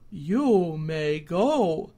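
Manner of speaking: a wise judge letting him off; solemn scarecrow; very slow scarecrow. A narrator's reading. Scarecrow releases goat.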